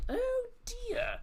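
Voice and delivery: "Oh" leaps very high in mock alarm; hammed-up dismay. Host mocks alarm at Celtic's injured attackers. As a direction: squeal it like a scandalised aunt.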